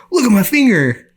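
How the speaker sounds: high and loud, proud call; eager show-off. Retold or imitated. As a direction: show them the injury eagerly. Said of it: "I'm like".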